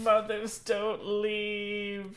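drawn out slow, mock tragic; milk the kids' plea, very slowly; slow mock wail. Podcast host imitating the crying kids, slow and mock tragic. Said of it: "the kids run after the car saying".

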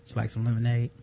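low, calm stern host voice; Chris Hansen impression. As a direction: quietly tell him to sit. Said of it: "that Chris Hansen".

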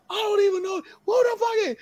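very high-pitched angry ranting; retold outraged squawk; going off. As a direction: imitate a boss dismissing a nobody at the top of his range. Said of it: "He's just going off".

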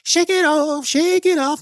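pep-talk yourself up in a high singsong; chirpy high shake it off; high sing-song. Real, not acted.

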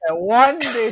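normal talking voice

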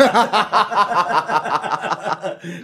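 loud open laugh at a friend's expense; hearty laugh. Real, not acted. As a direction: burst out laughing. Guest laughs about his friend.

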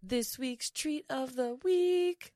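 slow drawn-out announcer voice. Pretend. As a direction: stretch it out like a show jingle. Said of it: "I know, that was good".

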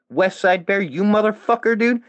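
taunting callout to a rival streamer; high taunting call-out. Real, not acted. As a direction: call him out mockingly, high.

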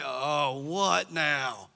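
muttered, low sarcastic grumble; sarcastic kid. Retold or imitated. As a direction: sigh it out like a fed-up kid. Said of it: "There's a lot of sarcasm".

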